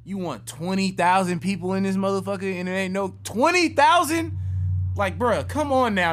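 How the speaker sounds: loud, high-pitched disbelief; incredulous outburst. Real, not acted. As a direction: exclaim it high and loud, unable to believe it. Incredulous at crowd size.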